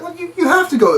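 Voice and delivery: high, incredulous protest; high exasperated voice. Retold or imitated. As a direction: squeak it out in disbelief. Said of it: "He's like".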